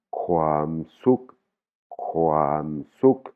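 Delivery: « kwam suk » avec « suk » bref, qui monte; voyelle courte thaïe. Shown as a demonstration. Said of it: "c'est voyelle courte". (prononciation thaïe, « suk » bref)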